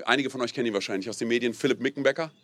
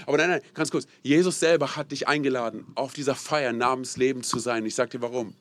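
ordinary talk, nothing marked

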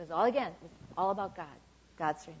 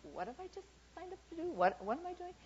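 high, soft worried self-questioning; lightly played anxious doubt; acted fear; 9 semitones higher, 7 dB quieter than usual. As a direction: voice a mild panic of second thoughts. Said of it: "There can be a lot of fear in this".

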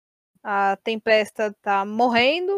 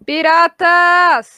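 drawn-out battle cry shout; 6 semitones higher, 10 dB louder, much slower than usual. Real, not acted. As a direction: yell the show name, stretched slowly. Show sign-off battle cry. (grito arrastado)

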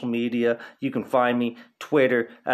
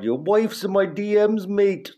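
put-on quoting the fighter in a higher Irish accent; a raised Irish-accent imitation of the trash talk; 7 semitones higher than usual. Podcaster mimicking the Irish fighter's trash talk, in an Irish accent. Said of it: "This guy has the thickest Irish accent ever".

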